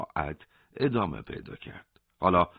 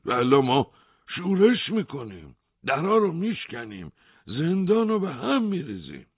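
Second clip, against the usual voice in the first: rising, higher defiant threat; building threat; 7 semitones higher than usual. A narrator's reading. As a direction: build up to a riot warning. Hossein threatens a riot.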